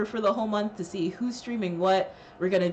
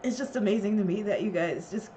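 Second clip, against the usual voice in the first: tearful; 11 semitones lower, 8 dB quieter than usual. Real, not acted. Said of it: "I'm really gonna cry now".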